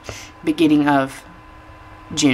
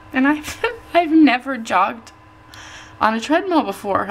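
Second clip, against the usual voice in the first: emotional excitement; 7 semitones higher than usual. Real, not acted. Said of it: "I'm really super emotional".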